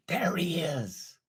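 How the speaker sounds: hushed, unvoiced whisper. Mentor imagines strangers whispering as Robert passes. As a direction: breathe it like a rumor in the room. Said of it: "You're gonna hear".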